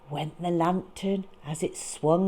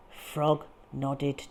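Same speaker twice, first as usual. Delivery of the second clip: plain reading voice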